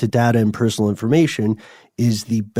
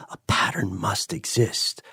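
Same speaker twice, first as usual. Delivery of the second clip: soft murmured inner voice; 7 dB quieter than usual. Acted. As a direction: murmur it like a thought in your head. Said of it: "little voice in your head is always going".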